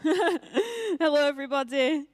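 bright, loud hello to the room; excited greeting. Real, not acted. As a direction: greet everyone with a big lift.

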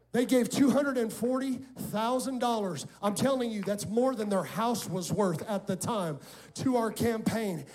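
loud, rising preacher's climax; preaching at full force. Acted. Pastor rousing the congregation to give, loud preaching. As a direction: build the church up to a shout.

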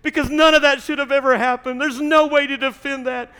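high, loud, impassioned preaching; preaching at full force. Acted. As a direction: build the testimony to a fiery peak. Preaching climax of the story.

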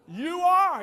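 high, loud climax on you; preaching shout. Real, not acted. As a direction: drive the point home loud.

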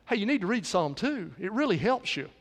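ordinary talk, nothing marked

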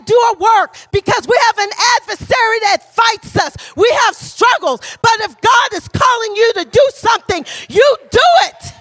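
loud, high preaching build to a shout; full-force preaching. Acted. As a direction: preach it out, rising to the last words.

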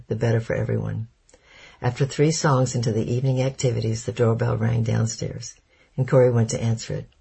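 plain reading voice

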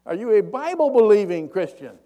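high, loud acted holler; full-voiced long-distance call; yelling up. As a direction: shout the question up to someone far above. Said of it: "He yells up at him".

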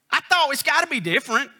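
high-pitched retold protest; animated disbelief. Preacher retells his puzzlement over matching Hebrew words, animated disbelief. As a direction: insist on it in a high, incredulous voice.

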